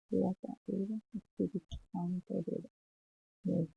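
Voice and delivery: quiet prayer. Real, not acted. Closing sign of the cross.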